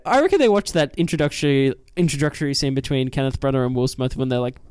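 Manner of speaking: usual speaking voice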